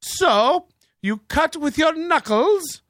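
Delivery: high affected mimicry dropping back to a normal voice; mocking imitation, high then falling to plain. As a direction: start high and put-on, then slide out of it. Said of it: "She's out of it".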